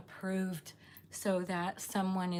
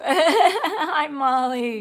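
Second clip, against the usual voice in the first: laughing; 6 semitones higher, 13 dB louder, much slower than usual. Real, not acted. Laughing greeting.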